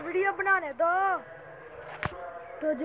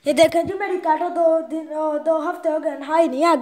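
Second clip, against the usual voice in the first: ordinary talk, nothing marked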